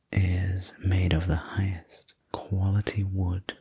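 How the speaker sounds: ordinary delivery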